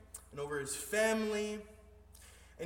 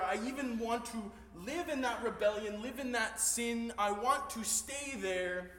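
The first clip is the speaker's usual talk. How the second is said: louder, higher, driving sermon build; preaching at full force; 4 semitones higher, 6 dB louder than usual. Acted. Pastor pleads with the congregation, preaching loud and high.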